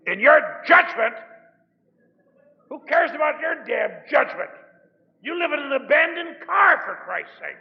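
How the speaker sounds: high, loud scornful yell; sponsor shouting. Retold or imitated. Sponsor yelling at the homeless newcomer, angry shout. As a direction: bellow it at him like a fed-up sponsor. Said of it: "he'd say".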